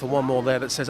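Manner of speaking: usual speaking voice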